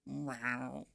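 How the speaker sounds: rising cat mutter; cat grumbling. Acted. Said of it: "she's always kinda like".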